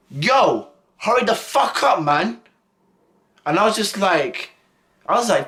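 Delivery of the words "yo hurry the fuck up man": mock angry snap, higher pitch; angry classmate. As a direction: play the hothead demanding he hurry. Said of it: "He was like".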